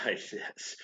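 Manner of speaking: ordinary delivery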